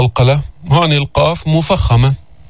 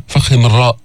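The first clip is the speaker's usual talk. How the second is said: ordinary talk, nothing marked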